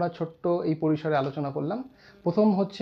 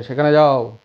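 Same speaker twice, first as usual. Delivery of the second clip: firm command from a parent; loud order; 4 semitones lower, 8 dB louder than usual. Retold or imitated. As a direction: order the child loudly. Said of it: "জোরে order করছে".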